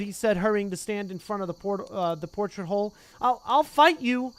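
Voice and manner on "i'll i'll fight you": slow, loud, halting threat; nervous threat. Acted. Neville blocking the portrait hole, slow loud threat. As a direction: square up with shaky resolve.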